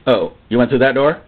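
low, wary question rising at the end; low-pitched doubtful question; disapproving questioner. Acted. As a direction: ask it with restrained disapproval. Acted church leader questions the man.

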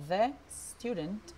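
"The student" said slowly with no E before S; correct model. Shown as a demonstration. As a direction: start "student" straight on the S. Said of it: "una E antes de la S".

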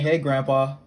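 high, very loud phone greeting; loud happy announcement. Real, not acted. Partner telling grandpa by phone, loud announcing. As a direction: call it out down the line.

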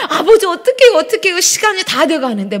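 high, loud, retold panicky prayer; frantic pleading. Late pastor begging God in traffic, acted as frantic pleading. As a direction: act out a flustered, urgent plea. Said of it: "너무너무 막 조급해지는 거예요".

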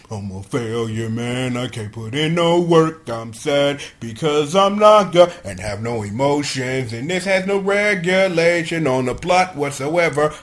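low, sulky self-pity; mock moping. Recapper voicing Naga left out of the fight, mock moping. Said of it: "leaving Naga to sit down there to stew in it".

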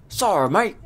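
high-pitched, hammed-up Australian accent; bad Australian accent. Player voicing a game character's reply, bad Australian accent. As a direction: put on a loud, bad Aussie twang. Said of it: "That's a bad Australian accent".

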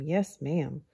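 usual speaking voice

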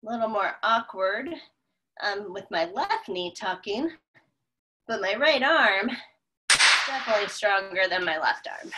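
usual speaking voice

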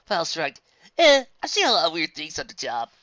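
usual speaking voice

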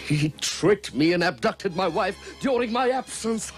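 loud, high outburst; anguished outrage. Acted. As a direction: cry out at the betrayal. Ram erupts over the abduction.

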